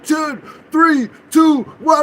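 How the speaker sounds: loud, high imitated police count; retold countdown at a shout. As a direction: shout it like cops about to breach.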